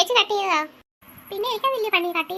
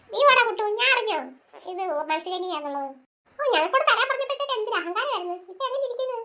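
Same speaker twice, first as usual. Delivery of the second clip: normal talking voice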